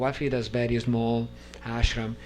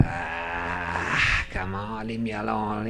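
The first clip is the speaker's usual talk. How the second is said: lower, grumbling brush-off; grumpy brush-off; 7 semitones lower, much slower than usual. Retold or imitated. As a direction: wave off the friend, grumpy.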